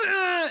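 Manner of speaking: strained push grunt, high and loud; mock heave of effort; effortful grunt. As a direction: grunt as if shoving hard. Doctors pushing the baby.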